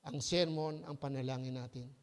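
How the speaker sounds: ordinary talk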